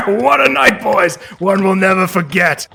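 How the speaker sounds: put-on rowdy drunk reveller. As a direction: cheer it out like a drunk man leaving a party. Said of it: "you hear a man say".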